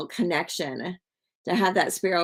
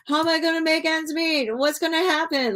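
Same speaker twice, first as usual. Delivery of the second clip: high-pitched mock panic; imitating own freak-out; 10 semitones higher than usual. Streamer retelling her bad day to viewers, mock freaking out. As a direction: act out a worried fret, pitched well up. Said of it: "I'm just, like, freaking out".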